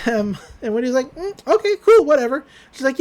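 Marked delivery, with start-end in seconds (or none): 1.1-2.4 breezy high voice. Retold or imitated. Wendy's casual high reply.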